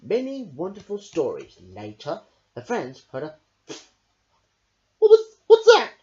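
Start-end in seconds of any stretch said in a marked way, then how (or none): 5.0-5.9 startled gasp. Acted. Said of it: "gasped Fox".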